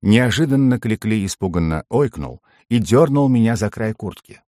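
ordinary delivery, nothing marked

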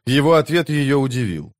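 usual speaking voice

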